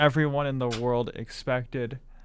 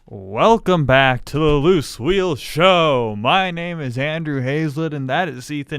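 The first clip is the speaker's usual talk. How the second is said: booming radio voice; 8 dB louder than usual. Acted. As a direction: boom it out like a radio DJ. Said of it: "Why are you talking like".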